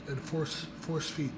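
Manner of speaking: ordinary talk, nothing marked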